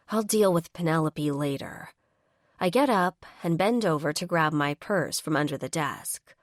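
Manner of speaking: usual speaking voice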